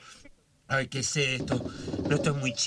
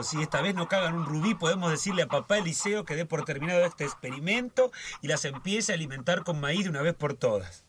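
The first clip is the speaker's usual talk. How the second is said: normal talking voice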